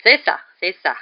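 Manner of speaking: loud, irritated brush-off; annoyed. A narrator's reading. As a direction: cut in with open annoyance. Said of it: "dit Fudge agacé".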